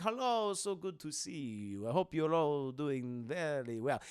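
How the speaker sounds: slow, hushed vampire count; Dracula voice. Retold or imitated. Dracula greets the doctor, slow and hushed. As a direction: purr it like a courtly vampire.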